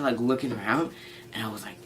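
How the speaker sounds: ordinary talk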